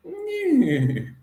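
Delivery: high, silly whinnying neigh; horse whinny impression. Shown as a demonstration. Mocking McGregor as Mister McHorse, a high silly neigh. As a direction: neigh like a horse to mock him. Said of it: "my Conor McGregor impression is just to imitate a horse".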